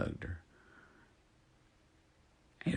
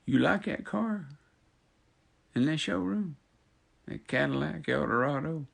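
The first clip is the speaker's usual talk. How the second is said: high [name] voice; 11 semitones higher, 7 dB louder than usual. Retold or imitated. [name] teasing the lady.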